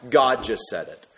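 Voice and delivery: normal talking voice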